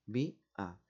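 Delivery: "Bi-, a" split by a clear pause; syllable by syllable. Shown as a demonstration. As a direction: say "Bi-, a" as two detached syllables. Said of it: "silábicamente".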